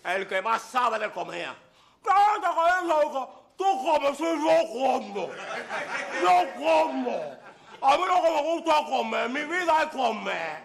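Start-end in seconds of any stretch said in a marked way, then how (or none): 2.0-3.4 louder, higher cocky challenge; comic outburst. Acted. Comic character bursts in. 3.6-7.4 slow, drawn-out boast; slow punchline. Acted.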